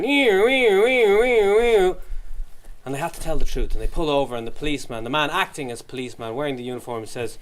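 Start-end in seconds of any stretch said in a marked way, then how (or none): 0.0-2.0 siren. Retold or imitated. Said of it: "a siren".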